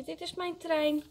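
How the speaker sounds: put-on high-pitched child's claim; calling out. A narrator's reading. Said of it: "roept Frankje".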